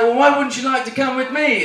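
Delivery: high-pitched mock officer; British officer. Officer to one-legged convict, high-pitched coaxing. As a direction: coax as a caricature British officer. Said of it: "the officer's like".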